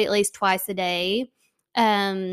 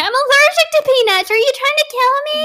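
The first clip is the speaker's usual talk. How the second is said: high, shrill put-on squeal, much higher; mock outraged child; 8 dB louder than usual. Friend acting out the child's accusation, high mock outrage. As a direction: squeal the accusation like a bratty kid. Second speaker voicing the child.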